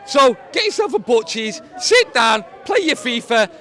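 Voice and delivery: loud, excited fan yelling; excited shouting. Real, not acted. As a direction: shout it over the crowd. Drunk fan shouting a message.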